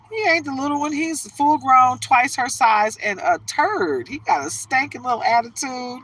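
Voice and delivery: exasperated rant. Real, not acted. Telling husband the dog is full grown, exasperated. Complaining about spoiled dog.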